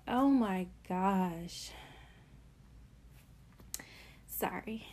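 panting after climbing stairs; breathless, catching her breath. Real, not acted. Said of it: "I'm a little out of breath".

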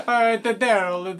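hammed-up Italian, drawn out; Italian accent. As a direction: stretch it out in a put-on Italian accent. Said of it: "an Italian accent".

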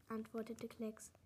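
ordinary delivery

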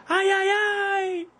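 high-pitched comic "ai" signal; mock cry-out. Friend demonstrating the secret signal to his mate, high mock cry. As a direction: squeal it out as a pretend moan. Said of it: "começa a gritar".

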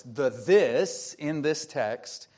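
"this" slowed and held, each word set apart; stress on this. Shown as a demonstration. Pastor explaining the text to his congregation, slow stressed "this". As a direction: lean hard on "this" like a name. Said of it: "That's an oddly worded sentence on purpose".

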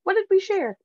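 high alarmed what did we share; alarmed question. Retold or imitated. Friend panicking over shared brush, high. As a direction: squeak with mock alarm. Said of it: "I was like".

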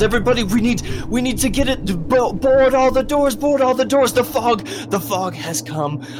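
high, loud frantic shouting; panicked mayor raising alarm. Acted. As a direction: shout a warning to the whole town. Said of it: "He starts ringing the bell".

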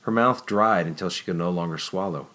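usual speaking voice

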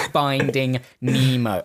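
slow, over-careful words for a phone; slow dictation voice. Retold or imitated. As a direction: say it slowly so the phone gets it. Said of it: "'Cause that's how you have to say it".

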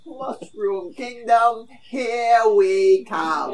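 loud, high, drawn-out trailer mimicry; Mario catchphrase imitation. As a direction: stretch it out slowly and loudly. Echoing Mario's trailer line.

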